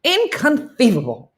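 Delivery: high-pitched, loud lisping outburst; movie catchphrase impression. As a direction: bust out the film catchphrase, high and lisped. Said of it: "that's how I have to say it".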